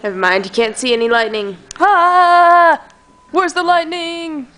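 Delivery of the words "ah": mock scream. Mock horror movie scream.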